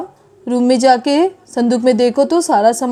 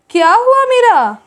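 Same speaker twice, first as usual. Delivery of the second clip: alarmed call; 8 semitones higher, 9 dB louder than usual. Acted. Family rushes in at the noise.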